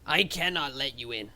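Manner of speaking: a hint of a gruff goblin refusing; firm little goblin no; lightly voiced goblin. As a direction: goblin guard refusing entry. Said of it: "he looks down and says".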